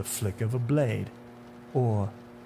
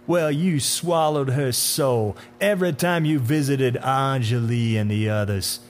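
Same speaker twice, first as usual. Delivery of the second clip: unhinged tirade; 2 semitones higher, 5 dB louder than usual. Acted. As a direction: berate him with drawn-out contempt. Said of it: "Something came unhinged in the man".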